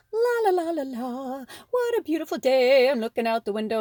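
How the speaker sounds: high, carefree la-la singsong; dreamy singsong lady. Pretend. Card figure humming at her window, carefree singsong. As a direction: float through it like a daydreaming lady. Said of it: "she's just like".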